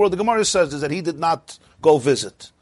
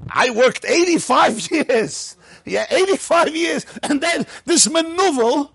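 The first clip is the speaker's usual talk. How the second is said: mock complaint; 9 semitones higher, slower than usual. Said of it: "you think, you know".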